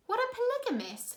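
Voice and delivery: put-on a puzzled young girl; curious girl. Violet asking her brother a word, high and curious.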